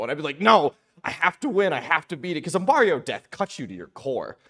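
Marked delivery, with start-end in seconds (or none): 0.4-2.4 put-on higher, louder kid insisting; desperate protest. Child arguing back at parents over a game, mock-desperate. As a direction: mock-desperate young gamer's outburst.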